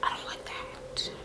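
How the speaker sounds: soft, breathy whispered disapproval; whispered aside. Real, not acted. Viewer whispering her dislike of a hat, soft and breathy. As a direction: whisper it like a secret complaint.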